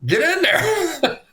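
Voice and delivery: high, drawn-out mock coach's order; exasperated coach. Storyteller as coach ordering a late player onto the field, exasperated. Acting the coach sending him in.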